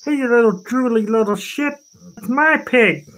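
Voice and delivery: high, indignant puppet frog; Kermit. Pretend. Frog claims his pig from the hound, high and indignant. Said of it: "Love you, Kirby".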